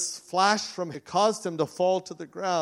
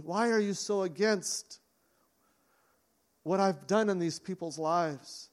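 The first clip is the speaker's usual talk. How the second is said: compassionate voice of Jesus; 3 semitones higher, slower than usual. Acted. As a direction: plead with him kindly, not harshly. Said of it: "also was full of compassion".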